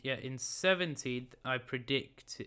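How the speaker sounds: normal talking voice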